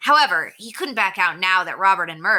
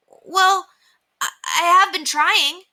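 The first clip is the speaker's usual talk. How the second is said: put-on high, louder flustered boy; nervous stammer; 12 semitones higher, 5 dB louder, slower than usual. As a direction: stumble over it, caught out. Henry caught out, stammering.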